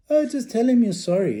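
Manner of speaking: high, loud dismissive mum; mother voice. Retold or imitated. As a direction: play the mother brushing it off. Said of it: "She was going".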